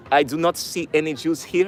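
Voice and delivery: put-on German accent; attempted German accent. Soldier pretending to search the attic, in a put-on German accent. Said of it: "trying to do a German accent".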